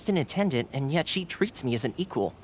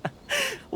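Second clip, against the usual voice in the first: bashful laugh; 4 dB quieter than usual. Acted. Thoma laughs at the praise.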